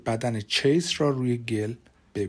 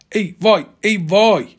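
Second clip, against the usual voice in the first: loud, higher-pitched exclamation; acted cry of dismay; 6 semitones higher, 7 dB louder than usual. Ambulance driver lifting a heavy corpse, loud acted exclamation. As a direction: cry out as you take the strain.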